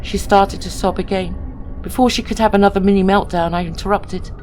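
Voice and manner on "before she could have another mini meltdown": ordinary delivery, nothing marked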